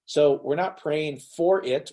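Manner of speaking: deliberate emphasis on "for"; stress on "for". Shown as a demonstration. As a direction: stretch and punch the word "for". Contrasts praying for with having.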